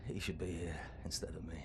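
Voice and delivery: soft unvoiced whisper of guilt; guilt-ridden whisper. Old fighter confessing his guilt to Blazkowicz, whispered. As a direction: breathe it out barely aloud.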